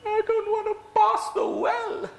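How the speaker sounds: high, pleading frightened voice; scared refusal. Acted. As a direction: beg not to go near the well. Frightened character refuses the well.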